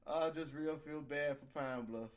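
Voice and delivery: ordinary talk, nothing marked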